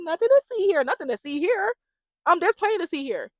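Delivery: high-pitched sing-out of denial; mock protest. Contestant answering friends who move her, mock protest. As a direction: imitate her brushing them off, pitched well up.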